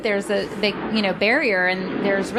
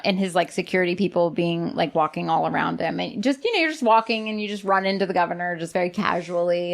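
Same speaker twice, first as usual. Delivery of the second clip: ordinary delivery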